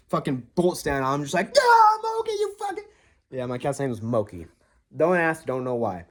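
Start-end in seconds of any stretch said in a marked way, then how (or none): 1.5-2.8 very high startled shriek at the cat; startled yelp. Retold or imitated. Startled by the cat on the dark stairs, shrieking high. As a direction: shriek it as the cat bolts past.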